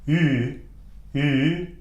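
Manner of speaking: "Ü" as a held, isolated German umlaut; pure rounded "Ü" vowel. Shown as a demonstration. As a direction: sound "Ü" with lips rounded forward. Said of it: "we had the umlaut".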